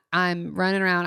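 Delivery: ordinary talk, nothing marked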